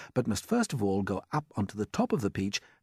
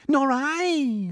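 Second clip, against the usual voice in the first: very high, louder worried reply; Miss Spider voice; 6 dB louder than usual. A narrator's reading. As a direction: chime in anxiously. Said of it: "said Miss Spider".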